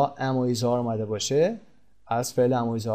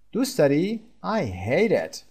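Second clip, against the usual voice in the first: normal talking voice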